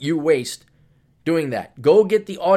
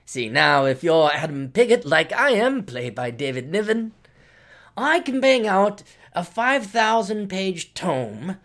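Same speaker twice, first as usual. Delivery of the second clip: mock British accent with swooping pitch; hammed-up British gentleman's accent; David Niven; 3 semitones higher than usual. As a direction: play a condescending Brit lecturing the Yanks. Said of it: "I'm just gonna do a David Niven accent".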